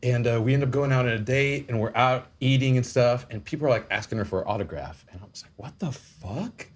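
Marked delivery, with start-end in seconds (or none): 5.6-6.7 hushed, drawn-out disbelief; baffled disbelief. Retold or imitated. Retelling his date being asked for autographs, hushed slow bafflement. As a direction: stretch it out slowly, much softer.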